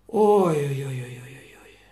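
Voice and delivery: a storyteller whispering a dark warning; whispered ominous oy. A narrator's reading. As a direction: hush it like a dark omen.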